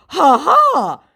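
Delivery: high, loud taunting ha-ha; a smug fake laugh mocking the victim; mocking scammer laugh. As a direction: cackle like you've just scored a point.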